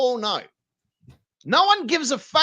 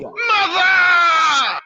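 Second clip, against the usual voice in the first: loud, high yell of the word Mother; shouted brand name; 6 semitones higher, 7 dB louder than usual. Pretend. Streamer yelling along to the ad, loud. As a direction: belt it out like a jingle tag. Echoing the energy drink ad.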